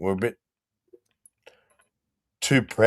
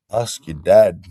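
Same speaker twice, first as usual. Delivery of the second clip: cheeky comeback; 8 dB louder than usual. Retold or imitated. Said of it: "You go".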